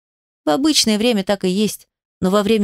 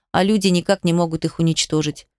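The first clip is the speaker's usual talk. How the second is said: ordinary delivery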